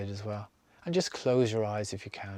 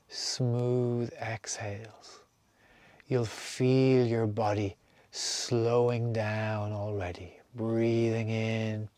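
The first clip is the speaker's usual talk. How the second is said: guided meditation; slower than usual. Acted. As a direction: lead them calmly from breath to breath. Leads a breathing meditation.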